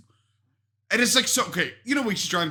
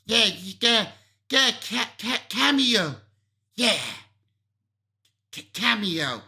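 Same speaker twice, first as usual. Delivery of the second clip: a lightly put-on cameo voice, stumbling on "cameo"; mock her weird cameo voice, stuttering; imitating her cameo voice; 2 semitones higher, slower than usual. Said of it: "her cameo voice".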